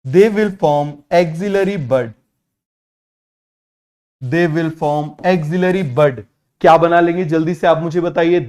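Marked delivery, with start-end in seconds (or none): 0.0-6.2 very slow, word by word for note taking; slow dictation. Shown as a demonstration. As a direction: spell it out slowly so students can write. Teacher dictates notes slowly.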